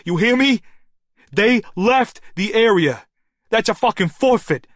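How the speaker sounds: loud, high yelling into the phone; desperate yell into a phone. A narrator's reading. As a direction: shout it down a bad line. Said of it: "yelling into it like a tin cup".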